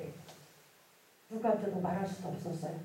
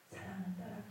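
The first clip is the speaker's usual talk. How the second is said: hushed, much softer divine address; voice of the Lord; 15 dB quieter than usual. Retold or imitated. The Lord calls the repentant daughter, hushed voice of the Lord. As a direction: quietly voice the Lord calling a loved one. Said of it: "주님의 음성이 들려왔습니다".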